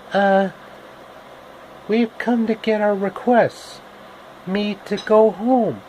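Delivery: put-on high girlish voice; girl's voice. Dorothy asking the Wizard for help, high and girlish. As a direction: pitch up into a young girl asking.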